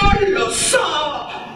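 high, drawn-out cry; shouting again. A narrator's reading. Dina shouts her answer to Miretta, crying out. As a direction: call it out to be heard. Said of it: "gridò ancora Dina".

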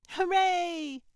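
put-on high, loud little-kid cheer; jubilant child's cry. A narrator's reading. Carl cheering as Dad gives in, jubilant. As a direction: cheer like an overjoyed small boy. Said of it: "Carl cried".

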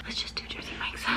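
hushed self-talk; whispering. Real, not acted. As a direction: whisper it to yourself. Whispers choice to herself.

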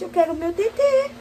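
put-on high baby voice speaking for the newborn; voicing the baby. Mother voicing the hungry newborn, high baby voice. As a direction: speak as the baby wanting milk.

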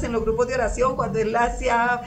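ordinary delivery

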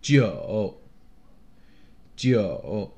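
"九" low, dipping then lifting; dipping third tone. Shown as a demonstration. Teacher marking tones on numbers, low and dipping. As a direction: bottom out on "九" and come back up. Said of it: "third tone".

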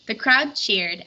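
usual speaking voice

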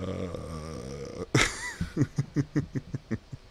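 long giggling fit, trailing off; long laugh. Real, not acted. Gamer laughing at own mistake, long laugh. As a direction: giggle helplessly on and on. Laughs after failing in game.

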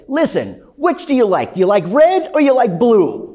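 loud, high Brooklyn sales pitch; Brooklyn salesman. Acted. Vacuum cleaner guy pitching at the customer's house, loud Brooklyn accent. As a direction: push the choice like a pushy Brooklyn vacuum seller. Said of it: "I made him from Brooklyn".

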